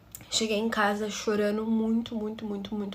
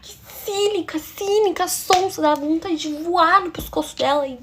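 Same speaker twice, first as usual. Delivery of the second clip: high, loud exasperated outburst; furious venting; 7 semitones higher, 7 dB louder than usual. Real, not acted. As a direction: spit out the insults, high and loud. Venting fury at stepsister's hypocrisy.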